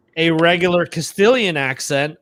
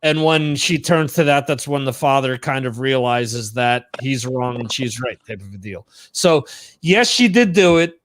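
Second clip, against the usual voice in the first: ordinary talk, nothing marked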